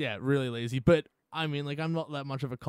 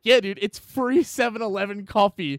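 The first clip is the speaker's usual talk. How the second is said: loud, exasperated retort; fed up, scolding a snob; 5 semitones higher, 6 dB louder than usual. Retold or imitated. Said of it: "I'm like".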